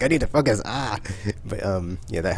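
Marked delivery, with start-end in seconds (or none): 0.0-1.0 frustrated. Retold or imitated. Frustrated by noisy teammates.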